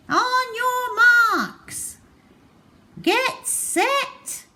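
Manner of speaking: high, slow, drawn-out starter's call; race starter call. A narrator's reading.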